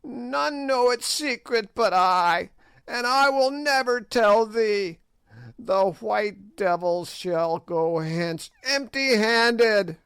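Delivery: high sneering witch; old witch voice. A narrator's reading. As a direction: play the ancient hag taunting the king.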